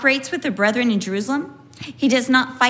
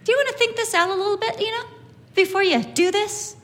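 calm polite question; 7 semitones higher, faster than usual. Retold or imitated. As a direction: ask gently, as a calm suggestion. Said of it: "No, he just says".

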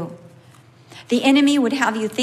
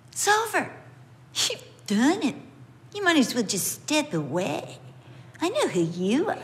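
a hint of a taunting accuser, pitched higher; lightly voiced accuser, mocking then hushed; the enemy's voice; 3 semitones higher than usual. Preacher voicing the enemy's taunt to the congregation, mildly sneering. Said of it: "That's what he sounds like to me".

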